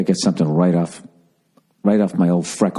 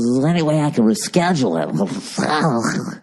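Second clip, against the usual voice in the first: slow, higher mock DJ voice; morning DJ voice; 5 semitones higher than usual. Radio guy asking comic to reschedule, mock morning DJ voice. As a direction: draw it out like a radio jock imitation. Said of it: "With your fucking morning D J voice".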